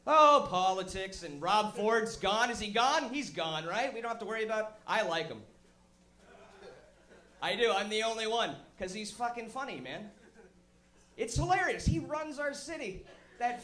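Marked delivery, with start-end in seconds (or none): none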